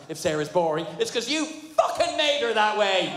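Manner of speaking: 最後だけ少しゆっくり、高めに怒鳴る; angry retort. Acted. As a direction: punch the last words up. Furious retort at the writer.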